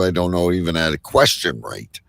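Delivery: ordinary talk, nothing marked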